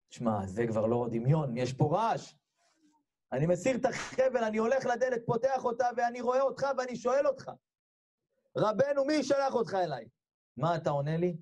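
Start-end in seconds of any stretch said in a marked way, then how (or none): 3.3-10.1 high, breathless-paced climax of a tale; excited reliving. Retold or imitated. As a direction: build urgently toward the big question. Saved man excitedly relives opening door. (התרגשות נסערת)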